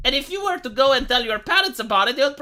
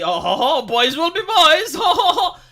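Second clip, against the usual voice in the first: hammed-up ho-ho-ho laughing it off; mock jovial indulgence; 5 semitones higher, 5 dB louder than usual. Mocking someone who excuses the unthinkable, hammed-up jolly chortle. Mocks a wrong reading of phrase.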